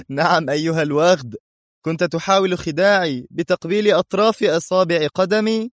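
high-pitched scornful jeer; mocking. A narrator's reading. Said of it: "بضحكة عالية ساخرة وقال". (سخرية لاذعة بنبرة مرتفعة)

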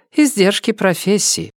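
high-pitched woman's voice; woman's character voice. A narrator's reading. Emilia excuses her disguises to Dani, in a high woman's voice.

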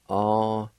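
"Au-ne-lo-hi" with a faint breathy H before "lo". Shown as a demonstration. Teacher showing students the faint H in "Au-ne-lo-hi". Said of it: "faint H sound right in front of the L O, like this".

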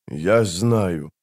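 ordinary delivery

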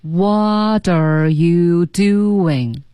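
every syllable stretched very long; super-slow pronunciation drill; extremely slow demo. Shown as a demonstration. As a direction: draw each word right out for learners to copy. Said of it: "我们再说几遍".

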